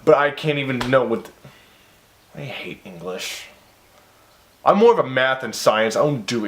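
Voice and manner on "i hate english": muttered gripe. Real, not acted. Grumbling after mispronouncing.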